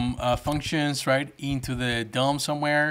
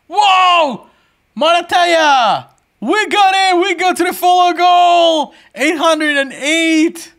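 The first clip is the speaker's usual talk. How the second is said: high, loud whoops stretched out long; real cheer at the top of the voice; ecstatic shouting; 14 semitones higher, 10 dB louder, slower than usual. Real, not acted. Streamer celebrating reaching the follow goal, ecstatic shouting.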